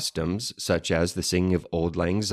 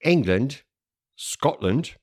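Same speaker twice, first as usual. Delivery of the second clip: mock British accents; 5 semitones higher, 4 dB louder than usual. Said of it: "I can't do the Welsh accent".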